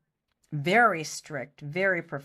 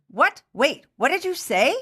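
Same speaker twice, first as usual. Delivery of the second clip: sudden high, loud disbelief; can't believe what he just heard; startled director; 8 semitones higher, 7 dB louder than usual. Retold or imitated. Director stunned by question.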